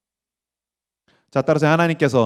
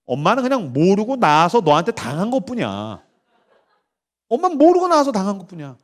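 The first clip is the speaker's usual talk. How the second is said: ordinary talk, nothing marked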